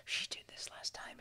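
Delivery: hushed, unvoiced whisper. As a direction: imitate judges whispering to each other. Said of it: "they're whispering".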